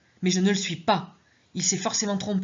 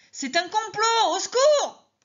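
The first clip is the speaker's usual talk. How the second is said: panicked scream; 4 dB louder, slower than usual. Acted. Panicked patient calling for help.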